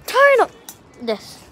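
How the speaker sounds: fed-up effort shout; frustrated yell. Real, not acted. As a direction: let it burst out while throwing. Frustrated throws.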